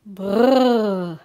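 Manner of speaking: loud, exaggerated brrr; hammed-up shivering noise; mock shudder. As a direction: roll out a theatrical shiver. Mock shiver after the scary story.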